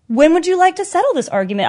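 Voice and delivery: high, politely formal offer; cordial. Retold or imitated. To two girls about to fight her, mock cordial and high. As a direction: sound like a receptionist scheduling it. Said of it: "most random cordial thing".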